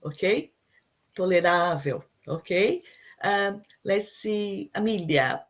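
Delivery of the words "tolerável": Tolerável with stress on the second-to-last syllable, ending in a w sound; teacher models Tolerável clearly for the student to copy; model Portuguese pronunciation. Shown as a demonstration. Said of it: "Say tolerável".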